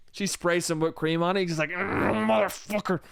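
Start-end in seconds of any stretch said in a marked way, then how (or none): 1.7-3.0 slow, growled curse; hammed-up hangry seething; angry growl. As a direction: growl up into the swear word. Hungry man growls at whipped cream.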